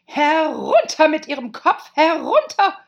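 high, very loud royal outburst; furious screaming. Acted. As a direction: scream the order in a rage. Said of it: "begann sie zu schreien".

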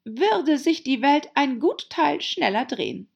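laute, hohe, knurrige Herzogin; heiseres Knurren. A narrator's reading. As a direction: gereizt und laut losknurren. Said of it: "knurrte die Herzogin heiser". (heiser geknurrt, laut und hoch)

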